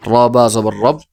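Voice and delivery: "র বা জবর রব" spelled out firmly, rising into a closed "রব"; tashdid first-half demonstration. Shown as a demonstration. Teacher showing the first reading with the preceding vowel, demonstrative spelling. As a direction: lift and close the ব at the end of "রব". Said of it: "প্রথমে একবার উচ্চারণ".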